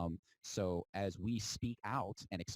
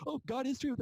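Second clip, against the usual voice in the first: mocking believer; 4 dB louder than usual. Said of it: "not truth".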